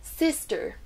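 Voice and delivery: SISTER with high SIS, falling light ending; first syllable stressed. Shown as a demonstration. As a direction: punch the SIS in SISTER, let the rest drop. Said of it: "La première syllabe là est prononcée plus forte".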